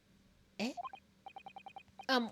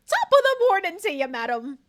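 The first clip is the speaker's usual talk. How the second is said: hammed-up stage Irish greeting, much higher and louder; mock Irish; 6 semitones higher, 10 dB louder than usual. Greeting the player in a hammed-up Irish lilt.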